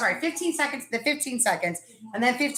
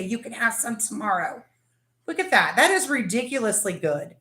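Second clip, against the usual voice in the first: usual speaking voice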